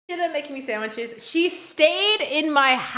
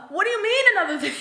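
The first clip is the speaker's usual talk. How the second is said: fast, laughing incredulous question; a quick laughing reply to the neighbor; laughing disbelief; 7 semitones higher, much faster than usual. Retold or imitated. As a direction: blurt it through a laugh. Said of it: "I'm like".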